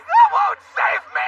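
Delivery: very high, loud yell; panicked shout. Acted. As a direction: shriek in panic.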